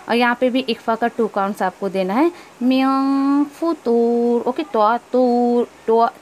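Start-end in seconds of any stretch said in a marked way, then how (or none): 2.6-6.1 मियं फुतूर drawn out slowly; slow recitation. Shown as a demonstration. Teacher recites the phrase for students.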